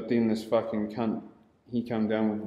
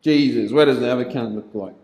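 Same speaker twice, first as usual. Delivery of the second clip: louder mock dad, brisk; dad rousing him; 2 semitones higher, 6 dB louder than usual. A lightly louder dad wakes his beaten son on the doormat. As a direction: bark him awake like a fed-up father.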